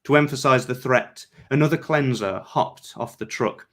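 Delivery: plain reading voice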